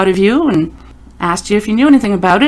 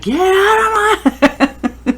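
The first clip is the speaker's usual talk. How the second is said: high, drawn-out mock mom yell; 12 semitones higher, much slower than usual. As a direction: holler it slowly like a fed-up mom, laughing after. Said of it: "mom voice".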